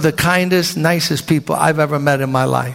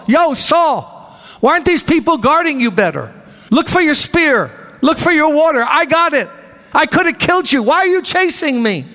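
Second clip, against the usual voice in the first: calling out; 8 semitones higher than usual. Acted. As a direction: shout it back from far away, taunting. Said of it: "yells back to Saul".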